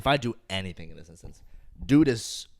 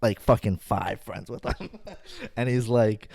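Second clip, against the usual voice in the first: ordinary talk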